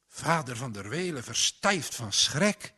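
ordinary delivery, nothing marked

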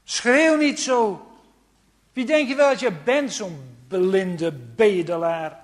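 loud scolding crowd. Acted. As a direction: shout him down, harsh and loud. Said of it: "Ze zeggen letterlijk tegen een man". (luid uitvallende menigte die de blinde berispt)

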